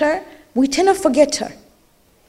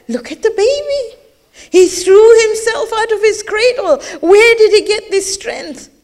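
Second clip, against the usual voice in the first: astonished mother; 8 semitones higher, 5 dB louder than usual. Acted. Rabab voiced in alarm.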